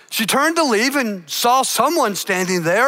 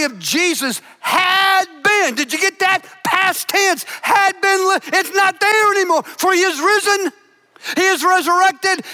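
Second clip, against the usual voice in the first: full-force preaching; 5 semitones higher than usual. Acted. Pastor shouting the resurrection to the congregation, full-force preaching. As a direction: preach it to the rafters, triumphant.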